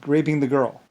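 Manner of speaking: ordinary talk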